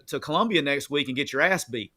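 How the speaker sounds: usual speaking voice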